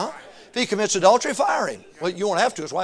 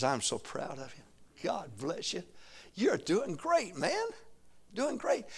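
gentle praise; 8 dB quieter, slower than usual. Retold or imitated.

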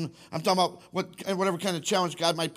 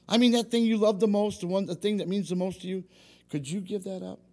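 normal talking voice

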